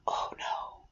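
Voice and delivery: whispered, ominous dismay; hushed mock dread. Aunt teasing nephews about the searchlight, whispered mock dread. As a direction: whisper it as if dreading what's ahead.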